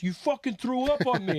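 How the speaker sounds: mock outrage from the bouncer he threw up on; outraged bouncer. Bouncer accusing him.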